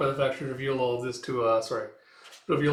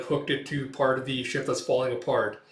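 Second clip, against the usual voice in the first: normal talking voice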